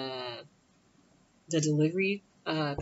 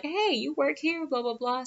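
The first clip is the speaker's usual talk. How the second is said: pitched-up, sing-out mock greeting; surprised greeting; 10 semitones higher than usual. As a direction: imitate mom hailing a relative. Mom greeting relative, retold.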